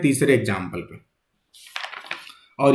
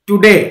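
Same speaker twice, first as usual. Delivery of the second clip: Today with firm, louder weight on डे; stress on second syllable; 3 semitones higher, 6 dB louder than usual. Shown as a demonstration. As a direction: press on the डे of today, leaving टु light. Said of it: "second syllable पे pressure बन रहा है".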